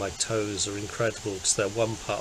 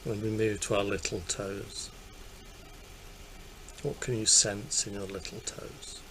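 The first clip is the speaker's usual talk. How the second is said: calm meditation voice, easing softer; guided meditation; 6 dB quieter than usual. Acted. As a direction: gently lead attention through the body, leaving long pauses. Leads a guided body scan.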